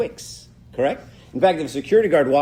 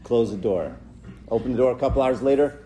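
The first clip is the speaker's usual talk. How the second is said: normal talking voice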